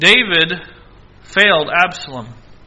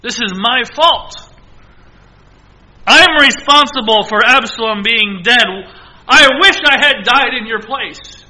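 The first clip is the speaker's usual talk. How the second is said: loud, high remorseful lament, fading at the end; David mourning Absalom, loud then sinking; grieving confession; 5 semitones higher, 7 dB louder than usual. Acted. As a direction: plead with guilt and grief. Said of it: "he is saying".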